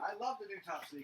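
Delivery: distant, off-mic voice; calling from the bathroom; from another room. Real, not acted. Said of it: "I'm in the bathroom".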